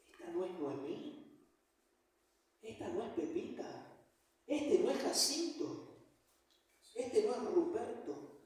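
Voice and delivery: slow, softly voiced disbelief; hushed disbelief. Townspeople seeing Noemí return, in slow, hushed disbelief. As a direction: act out quiet, mild astonishment, drawing the words out. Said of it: "no podían creer lo que estaban viendo".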